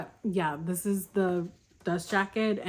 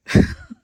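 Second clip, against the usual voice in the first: burst of laughter; 11 dB louder than usual. Real, not acted. Laughing at the dog.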